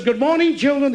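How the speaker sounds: high, sing-song schoolteacher; teacher greeting class. Acted. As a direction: chirp it to a roomful of children.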